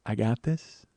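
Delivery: low, soft, unhurried murmur; cool reassurance. Retold or imitated. [name] answering the album outrage, low calm assurance. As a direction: play her calm against the uproar. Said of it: "She's like".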